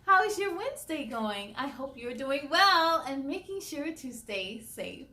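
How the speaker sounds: ordinary delivery, nothing marked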